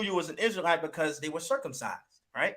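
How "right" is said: usual speaking voice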